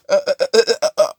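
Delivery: high, wordless stutter; panicked stammer. Acted. Falling back on the boat, panicked stammer. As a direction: stammer in mock terror. Character falls back in horror.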